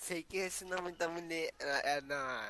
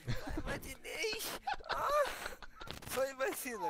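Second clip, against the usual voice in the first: high mocking imitation; imitating her; 7 semitones higher, slower than usual. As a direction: mimic her voice, teasing. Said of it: "imagina ela fazer assim". (imitação zombeteira, mais aguda)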